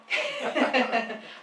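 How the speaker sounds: real burst of laughter; loud, spontaneous laugh at oneself. Real, not acted. Host caught without her sign-up sheet, laughing.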